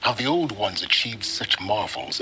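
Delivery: ordinary talk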